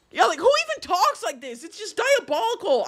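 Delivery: high-pitched incredulous outburst; appalled exasperation. Real, not acted. Reacting to the messages just read, high appalled disbelief.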